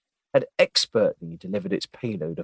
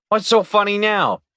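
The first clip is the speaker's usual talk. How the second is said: sharp demanding question, pitched up; 11 semitones higher, 6 dB louder than usual. Acted. Agent to sniggering partner, demanding. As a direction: demand an answer from the laughing partner. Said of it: "demanded Tanner".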